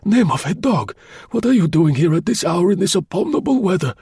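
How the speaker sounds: put-on bustle him in like a frail old doorman; old man fussing over a late visitor; fussy old concierge. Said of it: "he added".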